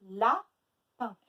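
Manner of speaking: LAPIN as loud la, pause, pin; split into syllables. Shown as a demonstration. Teacher asking where A is, syllable by syllable. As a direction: break Lapin into two beats. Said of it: "Où est-ce qu'on entend le A?".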